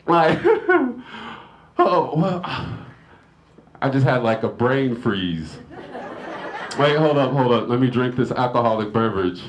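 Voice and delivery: normal talking voice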